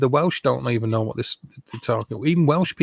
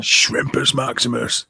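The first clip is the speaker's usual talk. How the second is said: slow, drawn-out imperial proclamation; a hint of pompous Roman grandeur; mock Roman emperor; slower than usual. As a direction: announce it like a lightly voiced Roman ruler. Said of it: "I'm Roman".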